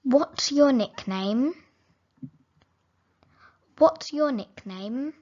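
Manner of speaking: normal talking voice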